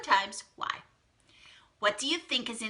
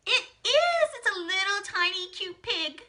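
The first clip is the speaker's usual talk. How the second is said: much higher, squealing with delight; delighted reveal; 8 semitones higher than usual. Real, not acted. Teacher revealing the mystery object to her class, delighted squeal. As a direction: gush over the tiny cute pig.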